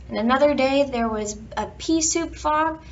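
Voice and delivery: plain reading voice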